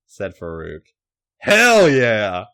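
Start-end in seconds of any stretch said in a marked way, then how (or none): 1.4-2.5 loud, eager hell yeah; eager shout. A narrator's reading. Bradshaw cheering on the scene with a loud shout.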